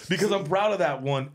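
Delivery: sheepish laughing repeat. Real, not acted. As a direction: laugh it out, a bit embarrassed.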